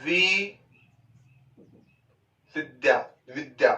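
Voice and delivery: विद्या built up from वि, with the द doubled as विद्-द्या; syllable by syllable. Shown as a demonstration. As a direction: break विद्या apart and stress the doubled द. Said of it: "इसका sound कैसे होगा?".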